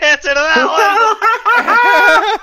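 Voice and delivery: high, loud, breaking into laughter; real laughing. Real, not acted. Friend teasing about JP's story, laughing hard. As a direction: crack up while getting the words out slowly. Cracking up over known story.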